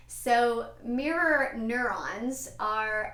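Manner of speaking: each syllable of mirror neurons drawn out very slowly. Shown as a demonstration. Host tackling her tongue twister for viewers, very slow. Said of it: "I have to say it very slow".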